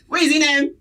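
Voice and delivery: loud, high playful call-out; mock demanding shout. Host demands the absent co-host, mock demanding shout.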